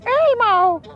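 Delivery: high, squeaky puppet voice; Elmo voice. Pretend. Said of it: "that was terrible".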